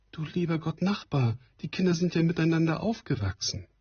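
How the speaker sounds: a gentle mother pleading, voiced up high; Frau Stine. Stine's voice in the reading. (leicht gespielte Frau, höher gesprochen)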